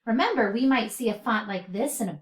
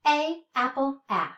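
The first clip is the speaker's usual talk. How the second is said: ordinary talk, nothing marked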